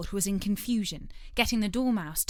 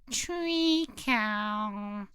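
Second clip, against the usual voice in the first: sleepy voice. A narrator's reading. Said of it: "said a sleepy voice".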